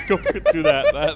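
usual speaking voice

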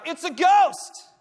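high, loud, slow shout of terror; terrified shout. Acted. Disciples in the boat shouting in terror at the figure. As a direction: scream it like you've seen a ghost. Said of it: "And they shout".

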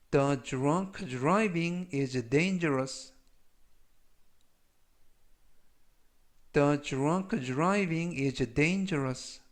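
slow, carefully spaced English model sentence; language-lesson model reading. Shown as a demonstration. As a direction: read it slowly for learners to repeat. Model sentences read for learners.

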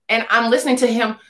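normal talking voice

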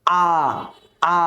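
long "আ" with lips drawn apart; long open vowel. Shown as a demonstration. Teacher showing learners a long, wide "আ". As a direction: open up and stretch "আ". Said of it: "দুই ঠোঁট দুই দিকে চলে যাবে".

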